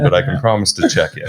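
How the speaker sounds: fast, amused promise; half laughing reassurance. Real, not acted. Joking promise, laughing after.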